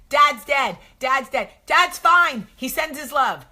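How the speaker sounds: loud, high hysterical rant; hammed-up sitcom meltdown; Jackie on Roseanne. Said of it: "feel like Jackie on Roseanne".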